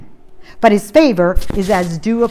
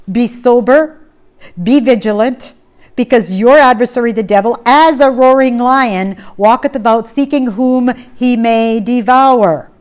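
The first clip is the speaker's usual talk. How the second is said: louder, proclaiming the verse; forceful scripture reading; 3 semitones higher, 5 dB louder than usual. Real, not acted. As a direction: preach the verse out strong. Reads scripture at full force.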